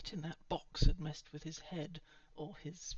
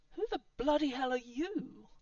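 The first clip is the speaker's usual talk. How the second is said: high, loud outraged question; indignant outburst, raised voice; indignant demand; 7 semitones higher, 7 dB louder than usual. A narrator's reading. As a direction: demand to know who intrudes. New voice confronts intruder.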